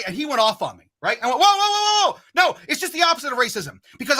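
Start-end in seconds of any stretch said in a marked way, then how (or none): 1.4-3.8 alarmed. Retold or imitated. Defusing the angry man.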